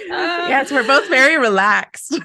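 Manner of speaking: laughing. Real, not acted. Laughing along at shared joke.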